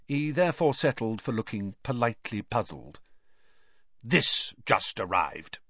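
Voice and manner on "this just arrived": low, slow, menacing uncle; Uncle Vernon voice. A narrator's reading. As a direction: lower gruff voice, drawn out. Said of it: "said Uncle Vernon".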